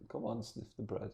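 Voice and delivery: soft, hushed coaxing. Acted. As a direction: gently urge someone who has fainted. Bakery ladies coaxing a fainted woman.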